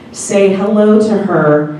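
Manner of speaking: normal talking voice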